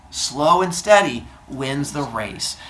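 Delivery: very slow, measured delivery at normal pitch; slow turtle. Acted. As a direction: draw out the moral, word by word. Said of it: "turtle turns to look at him and goes".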